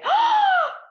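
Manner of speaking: very high, loud excited gasp; children's gasp. Retold or imitated. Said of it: "then the children say".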